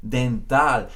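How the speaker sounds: dental with open final ah; Spanish dental. Shown as a demonstration. Teacher models Spanish dental to student, open ah ending. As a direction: close dental on a pure ah.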